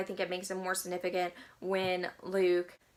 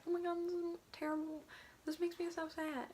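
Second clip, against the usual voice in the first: softer, higher, sighing dismay; playing up her own upset while reading; mock distress; 9 semitones higher, 10 dB quieter than usual. Vlogger recalls reading the sad scene, soft high mock distress. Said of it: "And I was like".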